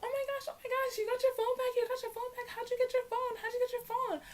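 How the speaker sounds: high, squealing gossip voice; excited classmates. Retold or imitated. Classmates in the locker room, high excited squeals. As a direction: gush like a crowd of schoolgirls. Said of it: "everybody's like".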